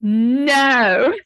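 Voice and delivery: loud, instant no, then a laugh; emphatic no. Real, not acted. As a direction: shut the idea down with a laughing burst. Instant firm answer to question.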